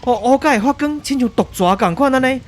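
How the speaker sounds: a newsman losing his nerve on air; terrified reporter. Acted. Reporter Carlo seeing tentacles emerge live, terrified and loud. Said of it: "咱继续转来甲卡洛这边".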